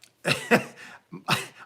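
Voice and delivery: real high, loud laugh; amused burst of laughter. Real, not acted. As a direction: crack up at your own joke. Laughing at own joke.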